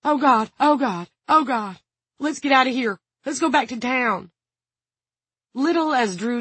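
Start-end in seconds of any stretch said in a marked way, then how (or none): 0.0-4.3 high, loud panicky pleading; gasping panic. A narrator's reading. Guard begs gunman to leave, panicking. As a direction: keep it high and urgent, desperate to flee. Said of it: "Dawn gasped".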